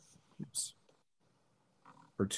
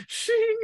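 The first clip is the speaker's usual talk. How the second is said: sword-draw sound effect; 3 dB louder than usual. Retold or imitated. GM voices a sword being drawn.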